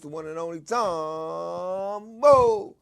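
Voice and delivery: slow, drawn-out announcer call of the name; hype host intro. Shown as a demonstration. Host hyping up his show audience, drawn out. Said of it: "Y'all gotta be able to say it like that".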